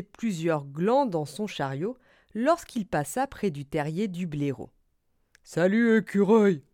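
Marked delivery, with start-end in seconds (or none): 5.4-6.6 loud badger greeting, not raised in pitch; calling out. A narrator's reading. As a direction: cry out a hearty hello. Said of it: "s'écria ce dernier". (salutation criée de blaireau)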